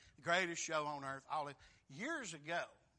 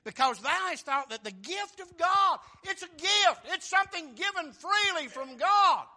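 much higher and shouted; full-force preaching; 13 semitones higher, 15 dB louder than usual. Real, not acted. Preacher thundering to the congregation that salvation cannot be bought. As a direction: preach it at the top of your voice.

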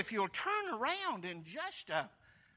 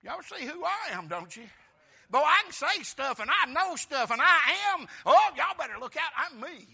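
cocky Peter; 5 semitones higher, 7 dB louder than usual. Retold or imitated. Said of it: "Peter's like".

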